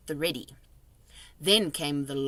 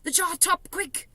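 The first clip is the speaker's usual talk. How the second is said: urgent hush; 11 semitones higher than usual. A narrator's reading. Urgent hushed request.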